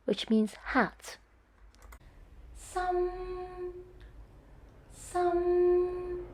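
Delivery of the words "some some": some held flat and high; high level tone. Shown as a demonstration. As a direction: keep some level at the top. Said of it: "The first tone is some".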